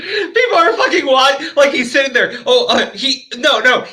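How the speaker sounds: high, loud, nearly crying with laughter; helplessly tickled, voice way up; laughing disbelief. Real, not acted. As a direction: squeal it through real laughter. Cracking up at the story.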